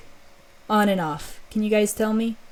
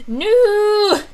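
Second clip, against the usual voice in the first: sudden high cry of shock, hammed up; mock dramatic no; 14 semitones higher, 6 dB louder than usual. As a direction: play the cliché soap-opera gasp. Mock dramatic gasp at accusation.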